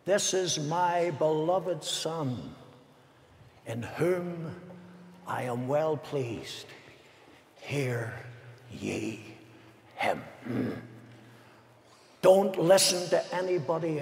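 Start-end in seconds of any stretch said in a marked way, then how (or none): 0.1-10.2 slow and much softer, ending in a whisper; voice of God the Father. Acted. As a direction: let the heavenly voice fade to a whispered "Hear ye him". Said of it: "Listen to what he said".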